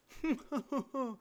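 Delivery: high, haughty mock laugh; high and mighty laugh. Mimicking the clip's people, a high haughty mock laugh. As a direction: laugh down your nose like royalty. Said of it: "really high and mighty laugh".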